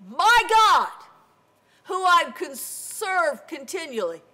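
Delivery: high, loud proclamation; calling up loudly. Acted. Daniel answering the king from the den, calling out. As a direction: project it proudly up out of the pit.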